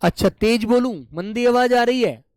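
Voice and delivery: speaking up. Real, not acted. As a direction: raise your voice for the class. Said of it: "गुरुजी थोड़ा तेज बोलो आप".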